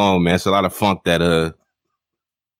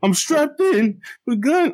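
mock rapper line; slower than usual. Retelling a battle rapper's line.

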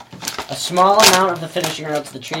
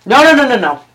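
loud refusal; 9 semitones higher, 11 dB louder than usual. Real, not acted. Refusing loudly.

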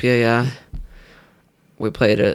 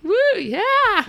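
high, whooping Woo, yeah; excited cheer. Real, not acted. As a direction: whoop it up like a fan. Cheering over a new iPod.

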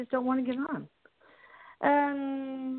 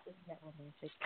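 ordinary delivery

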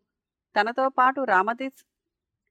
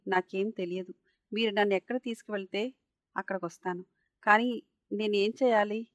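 ordinary delivery

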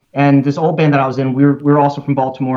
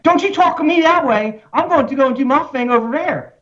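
high, indignant Baltimore accent; mock flare-up with the Baltimore accent bursting out; frustrated Baltimore accent; 11 semitones higher than usual. Shown as a demonstration. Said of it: "as soon as you get frustrated with someone".

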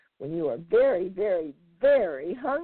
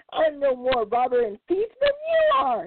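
shrieked; 7 semitones higher than usual. Acted. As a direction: shriek back at the heron. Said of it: "he shrieked".

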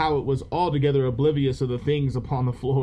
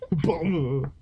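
ordinary talk, nothing marked

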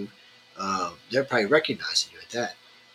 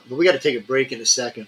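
normal talking voice

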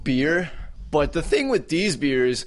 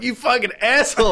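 mock angry outburst; 11 semitones higher, 5 dB louder than usual.

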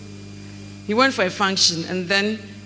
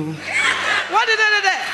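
higher, slow, laughing pestering; higher mock nagging, drawn out; nagging wife; 10 semitones higher, slower than usual. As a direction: drag out the prying question.

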